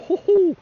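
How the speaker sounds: high loud whoop of delight; excited whoop. Real, not acted. Angler whooping at the rod, high and loud. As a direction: whoop like a bite just came.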